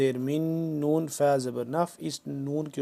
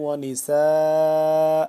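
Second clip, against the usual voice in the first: slow, drawn-out chanted tilawat; Quran recitation; 7 dB louder, much slower than usual. Shown as a demonstration. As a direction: recite melodically, stretching every vowel. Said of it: "آ ہو جائے گا".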